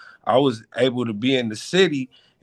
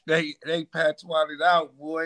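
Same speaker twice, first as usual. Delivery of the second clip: ordinary talk, nothing marked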